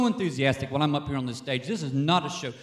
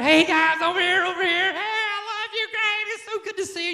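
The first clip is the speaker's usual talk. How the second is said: excited greeting; 14 semitones higher than usual. Shown as a demonstration. Spotting family at the airport, calling out excitedly. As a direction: call across the airport with joy. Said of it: "You're standing there".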